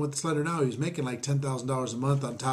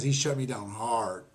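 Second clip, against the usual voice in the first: ordinary talk, nothing marked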